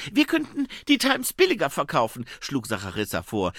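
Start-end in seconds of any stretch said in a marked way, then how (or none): 0.1-2.2 high, loud female character voice; junge Reporterin. Acted. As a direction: pitch it up, eagerly suggesting. Figure's suggestion in story narration. (hohe, laute Frauenstimme)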